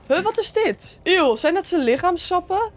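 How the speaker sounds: high-pitched baffled disgust; grossed-out surprise. Real, not acted. Player spotting body fluids, grossed-out surprise. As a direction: recoil at something gross, voice jumping up. Startled by gross sight in game.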